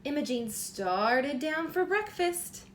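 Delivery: bright, lively storybook reading; reading to children. A narrator's reading. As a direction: read it like bedtime story time for a little one. Reads picture book aloud.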